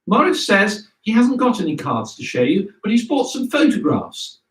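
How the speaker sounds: lightly voiced teacher presenting to children; headmistress announcing. Headmistress showing the cards to the hall, lightly announcing.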